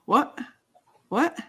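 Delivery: high, baffled repeated what; half-comic stunned disbelief; bewildered. Retold or imitated. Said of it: "and I'm like".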